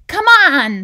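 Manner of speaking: loud, high rallying call; excited storybook character calling out; eager urging. A narrator's reading. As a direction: urge a friend to get moving. Anger eagerly urges On along.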